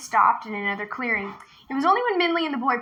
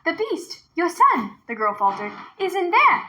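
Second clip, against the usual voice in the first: put-on high, urgent little girl's voice; a frightened girl luring the tiger; young girl; 7 semitones higher than usual. A narrator's reading. Girl leading the tiger to the well, high and urgent.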